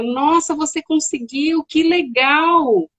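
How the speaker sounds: slow, admiring parent voice; delighted praise. Retold or imitated. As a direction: gush over a child's achievement, acted. Voicing parent praising child's play.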